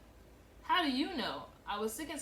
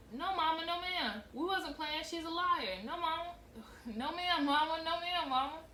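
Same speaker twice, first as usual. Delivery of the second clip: put-on high, frantic child begging; girl begging her mother not to whip her, higher pitch; pleading; 8 semitones higher, 4 dB louder than usual. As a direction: beg to be spared, pitch up. Child pleading before whipping.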